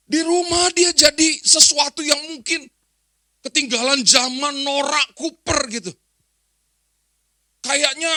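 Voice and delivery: loud, high-pitched pulpit complaint; impassioned preaching. Real, not acted. Preacher airs children's resentment of a parent, impassioned loud preaching. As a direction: voice the grievance forcefully to the congregation.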